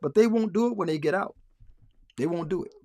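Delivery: ordinary talk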